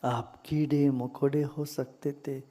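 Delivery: calm meditation guide with long pauses; guided meditation. Real, not acted. Teacher leading listeners into gratitude for body and breath, meditative. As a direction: guide them gently into stillness, unhurried.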